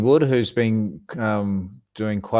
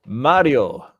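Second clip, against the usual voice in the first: a hammed-up Italian "Mario", louder; mock Italian accent; 3 semitones higher, 6 dB louder than usual. Host teasing his co-host by name, hammed-up Italian accent. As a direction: say "Mario" like a cartoon Italian, rolling it as "Madio". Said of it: "you gotta say it with an accent as well".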